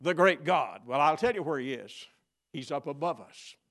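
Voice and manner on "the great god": skeptical challenge. Retold or imitated. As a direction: voice the doubter's challenge. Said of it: "You say".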